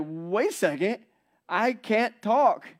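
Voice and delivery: startled mute priest; sudden realization. Acted. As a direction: panic dawning as he finds himself mute.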